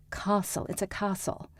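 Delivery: soft British "castle" with broad ah; British accent. Shown as a demonstration. Repeating the tutor's correction, soft British accent. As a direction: say "castle" as KAH-sul, quietly. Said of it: "did a British accent".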